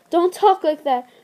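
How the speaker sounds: mate begging her to stop; panicked plea. Acted. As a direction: cut her off in fear. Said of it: "fought to keep the panic from his mew".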